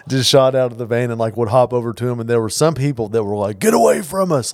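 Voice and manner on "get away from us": raised, higher mock yell; loud retold warning, pitched up; imitated yelling. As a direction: mimic people yelling someone off. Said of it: "just yelling at him and stuff".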